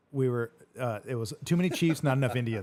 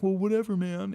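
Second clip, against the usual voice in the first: much higher, louder huffy brush-off; pissed-off friend; 8 semitones higher, 4 dB louder than usual. Retold or imitated. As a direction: sulk it off as he storms away. Voicing pissed-off Bobby.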